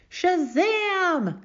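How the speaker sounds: high, loud triumphant yell. A narrator's reading. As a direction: yell it out like a comic-book magic word. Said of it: "Gladys yelled".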